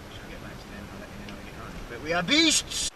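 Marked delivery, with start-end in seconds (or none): 1.9-2.9 big proud declaration; excited boast. Acted. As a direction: shout it like a proud beast. Crew boasting loudly.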